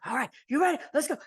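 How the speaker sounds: rally them like a drill sergeant; a touch of drill sergeant hype; imitated hype-up. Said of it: "gets them all hyped".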